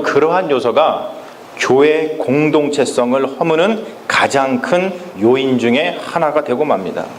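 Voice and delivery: normal talking voice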